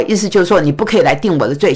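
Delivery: ordinary delivery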